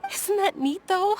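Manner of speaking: high, bright exclamation; delighted. Acted. Lady cheering friend's new mercenary plan, delighted. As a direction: sound genuinely thrilled.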